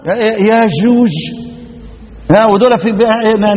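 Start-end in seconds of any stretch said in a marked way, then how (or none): 0.5-1.1 slow demo of zh sounds in يأجوج; softened jim. Shown as a demonstration. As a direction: say يأجوج as ya-zhuzh, slowly. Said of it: "معطشة".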